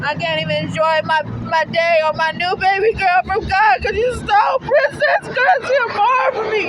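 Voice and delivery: usual speaking voice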